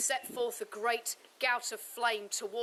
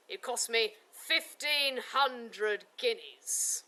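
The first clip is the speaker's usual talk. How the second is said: louder, boastful swagger; arrogant knight; 4 dB louder than usual. Acted. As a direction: show off like a vain golden-haired knight. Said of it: "very arrogant fellow".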